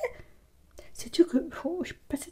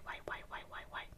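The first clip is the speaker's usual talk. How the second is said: whisper; 28 dB quieter than usual. Real, not acted. Whispered interjection before talk.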